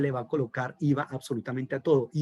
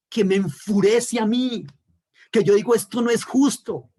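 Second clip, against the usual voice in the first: louder and higher, preaching in outrage; rising fury; 5 semitones higher, 5 dB louder than usual. Real, not acted. Pastor to his class, outraged at injustice, furious preaching. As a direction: build it into righteous anger, climbing.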